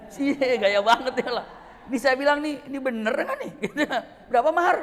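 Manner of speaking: loud, high, laughing disbelief. Real, not acted. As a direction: laugh at how casually he offered. Laughing at sheikh's offer.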